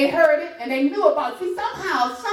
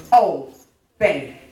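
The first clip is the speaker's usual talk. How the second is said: low drawn-out "Obey."; drawn-out demo; 12 semitones lower, 3 dB louder than usual. Shown as a demonstration. Preacher modelling "Obey." for the congregation. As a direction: stretch "Obey." low and slow for the church to echo. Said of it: "Say it to your neighbor".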